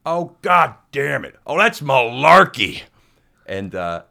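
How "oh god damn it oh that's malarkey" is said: loud, slow exasperated shout; angry coach yell. Retold or imitated. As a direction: bellow it from three courts away. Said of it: "we heard Rocky Ryan go say".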